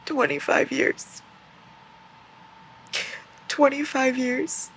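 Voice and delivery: high, slow disbelief; shocked at sentence length; stunned. Real, not acted.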